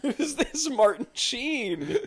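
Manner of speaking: slow, high, laughing mock wonder; mock awe through laughter. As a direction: marvel while cracking up.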